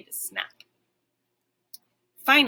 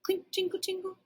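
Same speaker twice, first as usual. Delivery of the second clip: soft, hushed tinkling words; whispered sound effects; 10 semitones higher, 6 dB quieter than usual. A narrator's reading. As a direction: breathe them like faint chimes. Imitating faint sounds heard.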